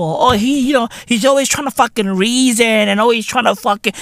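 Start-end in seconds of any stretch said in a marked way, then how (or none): none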